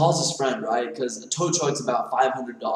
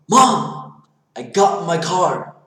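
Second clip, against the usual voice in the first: put-on proud boyish announcement, pitched up and loud; triumphant son; 3 semitones higher, 6 dB louder, slower than usual. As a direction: call out the win to mom. Son triumphantly telling mom.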